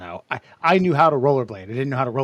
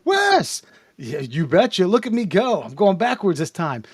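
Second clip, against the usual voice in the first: mock excited rink cheer; 5 semitones higher than usual. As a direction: cheer him on as he skates by.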